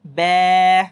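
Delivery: teacher models the thin letter; thin be, lips spread. Shown as a demonstration. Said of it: "किस तरह से".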